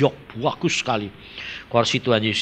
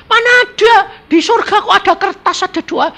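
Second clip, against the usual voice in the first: mocking quote; 7 dB louder than usual. Mocking a doubting minister.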